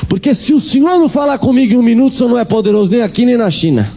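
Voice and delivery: mock cry out to God in her voice; mother crying out. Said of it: "E ela gritava".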